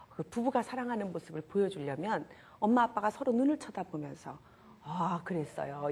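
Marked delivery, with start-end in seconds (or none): 4.8-5.9 slow, low, gentle acknowledgment; attentive reply. Shown as a demonstration. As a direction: answer your spouse as if truly listening. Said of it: "이래야 돼요". (배우자에게 공감하며 천천히 낮게 대답)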